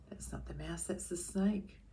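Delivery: soft unvoiced whisper. Real, not acted. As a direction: breathe it like a secret. Whispered aside to listening child.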